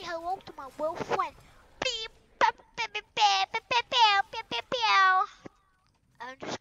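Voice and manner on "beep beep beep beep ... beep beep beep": higher chirpy beeping; playful machine beeps; mouth beeps. Pretend. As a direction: beep like a device. Imitating beeping.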